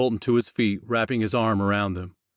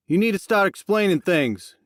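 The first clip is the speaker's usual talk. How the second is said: angry; 10 semitones higher, 3 dB louder than usual. Acted. Jackson confronts Max.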